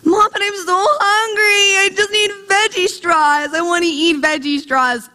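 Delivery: mock tearful four-year-old begging mom; whining child. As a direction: whine as if starving, words stretched out. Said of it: "with the whining".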